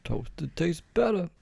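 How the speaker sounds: high, slow put-on pitchman voice; Quiznos ad guy. Quoting the Quiznos slogan again as a mock ad pitchman. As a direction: croon the slogan like a goofy ad announcer. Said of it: "He was like".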